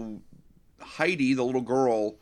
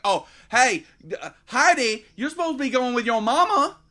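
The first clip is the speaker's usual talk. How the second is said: high, loud, overdone Southern mama voice; mock a hammed-up Southern mother calling her kid; exaggerated Southern twang; 7 dB louder than usual. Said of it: "where the hell did this voice come from".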